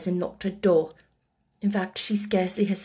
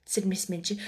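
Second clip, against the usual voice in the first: ordinary delivery, nothing marked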